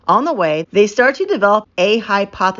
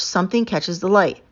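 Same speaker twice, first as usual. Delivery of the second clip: ordinary delivery, nothing marked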